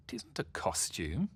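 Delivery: ordinary delivery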